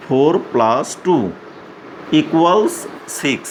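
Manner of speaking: each word said slowly and clearly for the class; slow English read-out. Shown as a demonstration. Teacher showing young pupils how to read it, slow. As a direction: dictate it word by word. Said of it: "কিন্তু এখন আমরা এটাকে বলবো".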